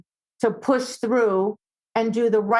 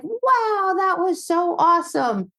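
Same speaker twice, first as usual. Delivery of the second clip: high, bright praise for the dog; excited praise; 8 semitones higher, slower than usual. Retold or imitated. Trainer praising her dog after a fix, high and excited. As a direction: gush over the dog's success.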